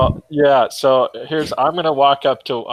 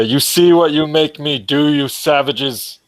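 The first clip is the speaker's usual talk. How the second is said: raised, higher voice berating the enemy; in-character outburst; 5 semitones higher, 4 dB louder than usual. Acted. Dougal rounds on fallen savages he is stomping, indignant outburst. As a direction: cry it out at the savages. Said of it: "and I will say".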